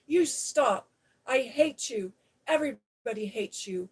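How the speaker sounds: furious shouting. Acted. Mary yells furiously at the screaming Colin. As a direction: let your temper explode at him. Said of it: "ran across the room".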